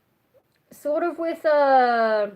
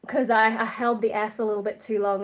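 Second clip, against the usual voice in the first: ordinary talk, nothing marked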